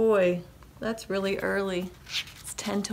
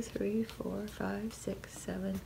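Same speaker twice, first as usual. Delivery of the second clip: hushed, very soft count; whispered counting; 13 dB quieter than usual. Real, not acted. Reader counting pigs with the kids, whispering. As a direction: whisper the numbers one by one.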